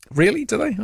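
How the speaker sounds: mock-surprised, gently sarcastic really; sceptical surprise. Real, not acted. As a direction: pitch jumps high on a doubting really. Host doubting a texter's claim.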